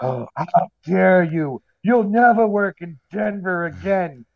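slow, drawn-out offended protest; mock outrage. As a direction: imitate a pompously affronted comic, stretching the words. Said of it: "take this shit too seriously".

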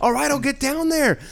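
high, strained retold reply; mock exasperation. Acted giving in to naggers.

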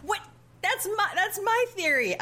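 high-pitched, indignant surprise; retold astonishment. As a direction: squeak it out in mock disbelief. Reenacts own reaction to quote.

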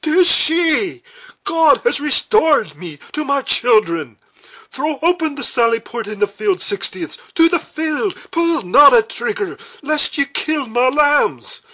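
high, full-volume anguished shouting; impassioned roar, drawn-out line ends; paternal agony. A narrator's reading. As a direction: shout desperately as a father saving children. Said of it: "awful tones of paternal agony".

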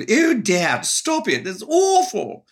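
very high posh English drama queen; posh English actress, drawn out and theatrical; Honey. Acted. Drama-queen dog protesting to Dad, posh English. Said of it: "she was kind of this posh English".

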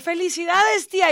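bright, high and loud congrats; cheerful congratulations. Retold or imitated. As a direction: congratulate her joyfully. Anesthetist congratulates the aunt.

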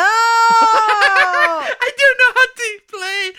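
high, slow shout through laughter; laughing shout. Real, not acted. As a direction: yell it while laughing helplessly. Laughing panic while scratching.

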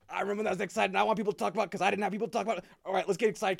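put-on match a kid's excitement, words tumbling out; retold gush of enthusiasm; getting hyped, re-enacted. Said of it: "getting hyped with him".

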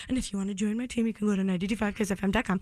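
fast, rattled-off web address; hurried radio plug; rushed plug. Real, not acted. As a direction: rush through the URL. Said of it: "no, say that slowly".

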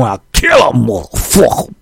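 mock hard-rapper threat; menacing rapper voice. Window Wash rapping on his track, mock menacing. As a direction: growl it like a hard rapper over the beat. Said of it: "Man, you hear that voice?".

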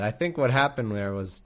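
usual speaking voice

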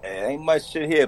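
ordinary delivery

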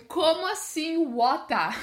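drawn-out, amused astonishment at "water"; baffled disbelief. Retold or imitated. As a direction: laugh through mock bewilderment. Baffled reaction to the Australian pronunciation.

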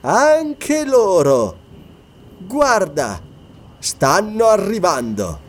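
slow, high-pitched taunting announcement; gloating witch. Acted. Atla answers Bran, announcing the creatures. (voce acuta e strascicata da strega)

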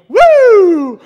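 high, loud imitated campaign yell "Woo!"; imitating Dean's woo. A speaker mimicking a politician's famous yell, high whooping shout. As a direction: belt out a whooping cheer like a crowd rouser.

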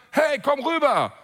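slow loud call across the road. Pretend. Crowd calls the blind beggar over, loud. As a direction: shout him over.

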